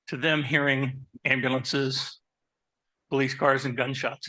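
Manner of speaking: normal talking voice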